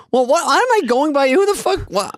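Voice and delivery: high, rising mock outrage; mock exasperated rant. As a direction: rant in disbelief, voice climbing high.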